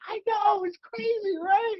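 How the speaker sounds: high, giddy impression of her; imitating co-star. Co-star agreeing that it's all crazy, high giddy voice. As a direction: squeal along in agreement. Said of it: "And she's like".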